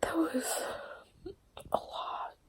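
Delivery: slow whisper, voice breaking into tears; teary whisper. Real, not acted. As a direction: whisper through crying, no tissues. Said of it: "I'm big sad".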